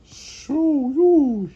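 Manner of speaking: high, loud, drawn-out holler; high-pitched "shoo" called out. Acted. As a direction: shoo them off with a high swooping cry. Frank shoos at animals.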